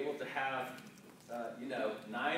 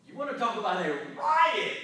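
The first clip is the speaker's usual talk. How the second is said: higher, louder restated riot; louder repeat; 8 semitones higher, 14 dB louder than usual. Shown as a demonstration. Preacher repeats to the congregation, louder and higher. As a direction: punch the riot line up.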